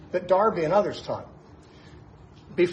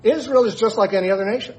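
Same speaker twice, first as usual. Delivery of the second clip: put-on louder, higher quote without the accent; quoting McGee louder and higher, no accent attempted; louder restart of McGee quote; 6 semitones higher, 6 dB louder than usual. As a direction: restart the quote with more force. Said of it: "I wish I could do the accent".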